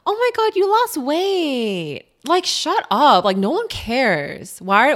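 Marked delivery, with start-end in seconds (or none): 0.1-2.0 much higher, very slow gushing mock voice; mocking gushing acquaintance. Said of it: "those people who are like". 2.3-3.2 higher, louder fed-up shut up; exasperated. Real, not acted. Exasperated reaction.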